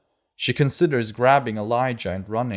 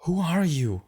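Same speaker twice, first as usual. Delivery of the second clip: put-on higher, girlish question; a girl asking from behind the door; girl's voice asking through the door; girly voice; 8 semitones higher than usual. A narrator's reading. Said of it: "a girly voice asks".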